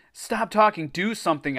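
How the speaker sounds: high, urgent plea, only mildly screamed; mock scream. A narrator's reading. Boy begging his friends to act, mild scream. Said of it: "I screamed".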